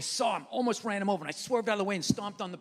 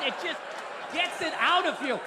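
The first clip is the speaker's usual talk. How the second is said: ordinary talk, nothing marked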